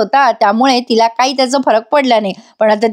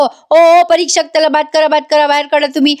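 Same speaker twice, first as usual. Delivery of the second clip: mock shouting; 4 semitones higher than usual. [name] demands the examiner throw him out, mock shouting. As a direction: call out sharply and rattle off the protest. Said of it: "तो इतका आरडाओरडा करतोय".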